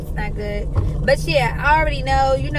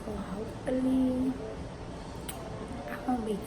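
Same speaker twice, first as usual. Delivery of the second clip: hushed talk; 2 semitones lower, 12 dB quieter than usual. Real, not acted. Waiting room, talking softly.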